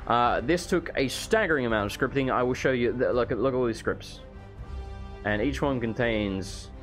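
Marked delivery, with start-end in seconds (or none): none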